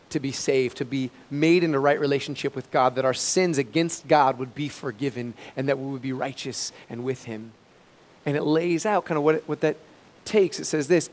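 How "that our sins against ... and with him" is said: normal talking voice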